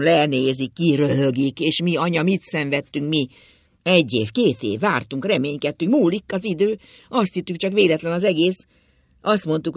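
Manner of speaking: loud, impassioned lament; a father's forceful, despairing plea; anguished outburst. A narrator's reading.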